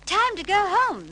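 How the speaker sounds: high, loud crisp order; crisp firm call. A narrator's reading. Mary Poppins ending the party, crisp and firm. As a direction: cut through the laughter sharply. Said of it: "crisp and firm".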